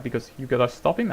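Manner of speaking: ordinary talk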